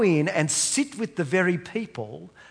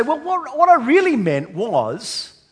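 much higher, hedging politician spin; a preacher imagining Peter spinning it like a politician; mock backpedalling; 9 semitones higher, 9 dB louder than usual. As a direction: squirm your way out of it. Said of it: "put a spin on this".

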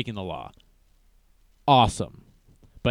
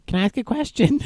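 much higher, a timid pleading put-on voice; 12 semitones higher than usual. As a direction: imitate the meek sidekick asking nervously. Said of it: "That's what he keeps saying".

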